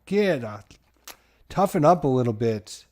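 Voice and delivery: normal talking voice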